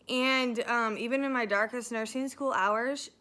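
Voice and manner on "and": usual speaking voice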